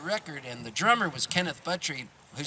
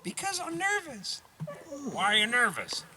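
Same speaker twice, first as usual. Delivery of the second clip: put-on much higher, jittery young singer's voice; 5 dB louder than usual. Nervous opener tells her idol she's scared, high giggly voice. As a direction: pitch way up, giggling through the nerves.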